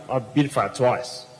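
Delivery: usual speaking voice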